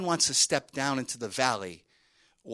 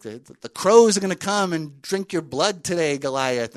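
talking smack; 4 semitones higher, 9 dB louder than usual. Acted. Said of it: "he talks a little smack".

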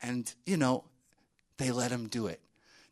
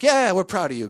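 mock hearty cheer; 7 semitones higher, 10 dB louder than usual. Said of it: "And Saul's like".

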